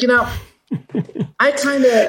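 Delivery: high, hammed-up voice of a working-class Jersey guy; Jersey comedian's guy. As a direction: pitch it way up as the comedian's everyman. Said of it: "He's like".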